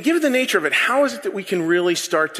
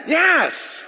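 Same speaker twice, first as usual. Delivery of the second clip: shouted yes; 10 semitones higher than usual. Real, not acted.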